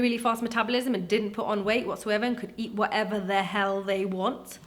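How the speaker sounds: ordinary talk, nothing marked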